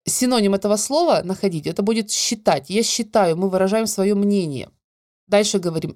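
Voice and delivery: ordinary delivery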